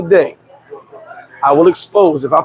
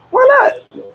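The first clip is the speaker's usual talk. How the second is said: incredulous. Real, not acted. Incredulous high question.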